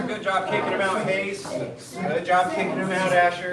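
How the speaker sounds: loud cheer over the count; called-out praise. Real, not acted. Coach praises Hayes and Asher mid-kick, calling out.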